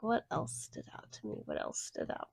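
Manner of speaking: soft whispered musing; recalling the movie, whispering to herself; muttering to self. Real, not acted. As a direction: half-whisper it to yourself. Thinking aloud to self.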